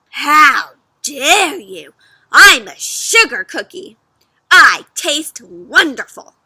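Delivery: loud, slow indignant; outraged cookie. Acted. Cookie insulted by the fox. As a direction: huff it out, insulted.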